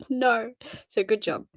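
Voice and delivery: normal talking voice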